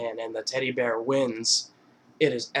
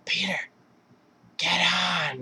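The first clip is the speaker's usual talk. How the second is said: higher-pitched pleading call; drunken Lois; 5 semitones higher, slower than usual. Retold or imitated. Drunk wife insisting to her husband, mock whiny call. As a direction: lightly mimic a drunk cartoon wife, stretching the words.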